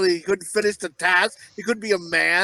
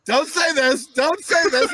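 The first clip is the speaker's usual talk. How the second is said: 1 panicked inner voice; 9 semitones higher, slower than usual. Retold or imitated. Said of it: "I was like".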